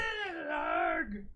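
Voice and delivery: crying-out protest yell; mock outraged shout. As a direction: wail it in fake indignation.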